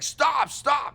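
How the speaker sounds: high frantic shouting; panicked shout. Retold or imitated. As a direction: yell like the car is spinning out. Agents panic in spinning limo.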